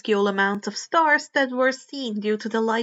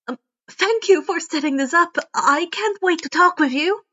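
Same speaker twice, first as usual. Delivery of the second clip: higher and louder, eager fanboy; nervous excitement; 6 semitones higher, 4 dB louder than usual. Acted. Izuku thanking his favorite hero, high and eager. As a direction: gush politely while holding it in.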